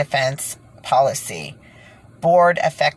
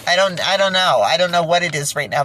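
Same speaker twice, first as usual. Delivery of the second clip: ordinary delivery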